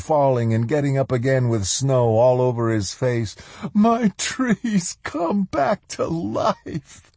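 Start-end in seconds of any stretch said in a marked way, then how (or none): 3.7-7.1 put-on high, joyous old man's cry, slow and drawn out; joyous shout. A narrator's reading. Sly Moody exulting over his revived tree, joyous shout. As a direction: cry it out with joy, stretching the words. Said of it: "Sly Moody shouted joyously".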